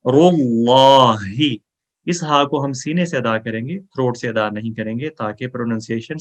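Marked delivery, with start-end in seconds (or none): 0.0-1.2 رو اللہ with the lam of اللہ full and heavy, slow. Shown as a demonstration. Teacher showing the heavy lam of اللہ, slow and full. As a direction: round out اللہ so its lam sounds thick and full. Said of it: "اللہ کے لام کو موٹا ... موٹی آواز میں".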